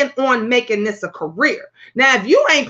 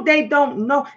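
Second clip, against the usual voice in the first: ordinary delivery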